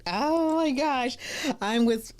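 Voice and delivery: high, slow, drawn-out gasp of embarrassment; mock fluster. Real, not acted. Host introducing herself, high drawn-out oh my gosh. Flustered after "I don't bite.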